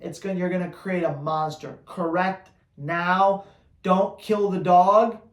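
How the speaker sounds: ordinary delivery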